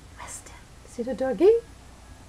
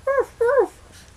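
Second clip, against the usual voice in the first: high playful fake dog bark; high mock woof; 9 semitones higher than usual. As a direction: bark like a little dog for fun. Playing the dog barking.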